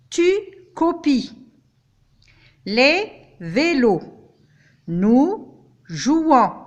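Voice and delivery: repas, tapis, pas, fois, copies, vélos, jouons with no final S; silent final S. Shown as a demonstration. Teacher listing words ending in a silent S. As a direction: leave every final S silent. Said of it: "des S que je n'ai pas prononcés".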